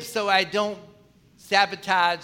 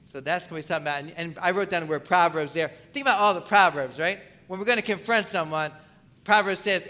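usual speaking voice

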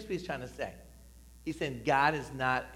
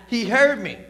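loud, higher proclaimed line; 8 semitones higher, 10 dB louder than usual. Shown as a demonstration. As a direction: belt it out for the congregation. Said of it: "Say it loud".

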